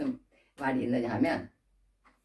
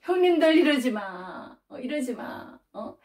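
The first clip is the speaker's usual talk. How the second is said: imploring; 6 semitones higher, slower than usual. Acted. Younger brother begging his brothers to stop, imploring. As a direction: plead with them in long, stretched words.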